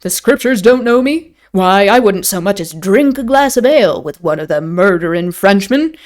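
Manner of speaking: hearty rustic English host, emphatic; boastful innkeeper. Acted. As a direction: bluster in tavern dialect with lively swoops.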